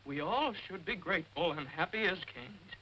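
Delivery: sing-song poem recital. Acted. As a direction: recite it like a sweet verse. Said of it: "I want to share a little poem".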